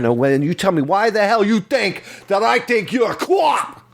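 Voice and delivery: harsh taunting interrogator, rising to a yell; mock interrogation. Interviewer grilling the young recruit, mock angry shout. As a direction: tear into the recruit, building to a shout.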